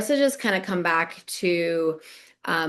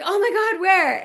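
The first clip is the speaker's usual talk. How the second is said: much higher, a little louder shock; gasping for the answer, pitched way up; excited shock; 12 semitones higher, 4 dB louder than usual. Retold or imitated. Wife asking where they're posted, excited high shock. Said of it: "And I was like".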